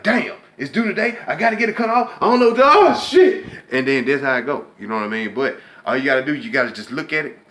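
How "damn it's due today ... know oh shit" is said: rising into a panicked, much higher, louder yelp. Retold or imitated. Imitating someone who panics at a bill due today, mock panic. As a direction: play the broke guy losing it as the bill comes due. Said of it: "You like".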